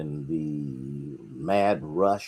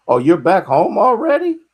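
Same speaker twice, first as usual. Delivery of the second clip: high, loud burst of surprise; surprised; 14 semitones higher, 11 dB louder than usual. Real, not acted. Host spots a viewer already back home, surprised. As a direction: exclaim it as a startled question, pitch way up.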